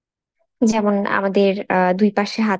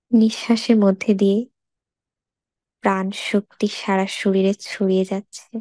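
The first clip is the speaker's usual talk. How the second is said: guided meditation. Acted. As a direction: lead a body scan unhurriedly, phrase by phrase. Leads a body scan meditation.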